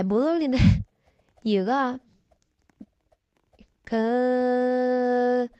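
normal talking voice

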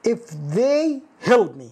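slow, careful reading; slow correct example. Shown as a demonstration. Teacher slowly gives the correct form. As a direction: say it slowly and clearly. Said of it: "لا تضع هنا".

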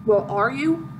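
usual speaking voice

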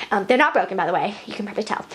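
ordinary delivery

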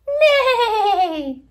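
loud, high, shaking whinny; horse whinny. Shown as a demonstration. Teacher ends the horse song with a whinny. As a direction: let out a big horse neigh. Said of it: "What sound does a horse make".

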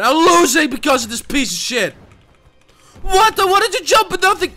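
loud, high angry yelling; pissed off. Real, not acted. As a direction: yell in outraged disbelief. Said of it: "I'm getting real pissed over here".

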